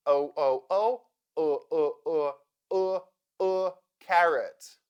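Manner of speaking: O repeated, then UH repeated, into carrot; schwa drill. Shown as a demonstration. Teacher drilling the unstressed second syllable of carrot, schwa drill. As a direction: drill O turning into a neutral UH before carrot. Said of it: "O sounds like uh".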